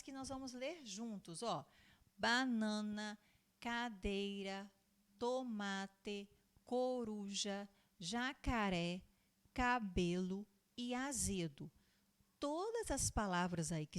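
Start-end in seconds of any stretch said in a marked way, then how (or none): 2.2-11.7 slow, stretched word-by-word reading; word-list reading. Shown as a demonstration. Teacher reading three-syllable words together with pupils, slow and drawn out. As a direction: draw out each word for young learners.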